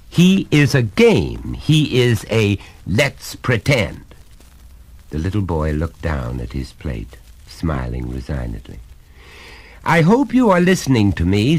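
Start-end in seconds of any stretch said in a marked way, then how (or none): 0.1-4.0 slow, higher, each word weighed; stern, deliberate insistence; emphatic explaining father. Acted. Father lectures his son. 9.8-11.6 higher, louder, hammering each point; stern father, pitched up and insistent; exasperated lecturing father. Acted.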